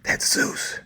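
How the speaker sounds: hushed warning. Retold or imitated. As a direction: whisper it like a secret danger. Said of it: "And they'll go".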